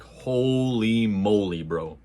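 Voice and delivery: slow, stretched-out awe; lightly hammed-up wow; awed. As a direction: drag it out, mock amazed. Awed reaction to Super Shredder.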